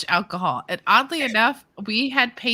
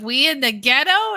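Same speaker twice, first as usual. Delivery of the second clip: louder, higher retold disbelief; incredulous question; 6 semitones higher, 5 dB louder than usual. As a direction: ask it in mock disbelief, voice rising. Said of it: "I was just like".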